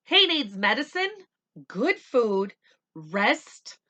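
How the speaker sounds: loud, high, urgent doctor; doctor's warning. Acted. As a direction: tell her gravely but firmly. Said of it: "he said".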